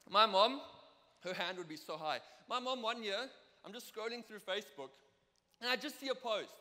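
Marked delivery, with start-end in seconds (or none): none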